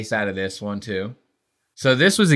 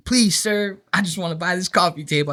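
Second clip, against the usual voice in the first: put-on higher, quick pleading old-lady voice; mock old lady plea; 6 semitones higher than usual. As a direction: beg like a fussy old woman at the counter. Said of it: "You see all these old bitches".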